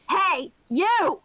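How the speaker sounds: sharp shouted call; yelling. Acted. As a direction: bark it at a stranger. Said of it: "as I yell".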